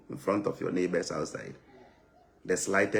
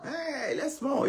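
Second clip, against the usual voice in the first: high, slow lament on "more"; high mock lament; slower than usual. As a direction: moan it high like a jailed friend.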